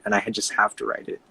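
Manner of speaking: ordinary talk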